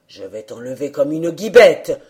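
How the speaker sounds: loud, low threat; menacing wind. A narrator's reading. The wind threatening to blow the old man away, low and loud. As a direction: boom it low and forceful.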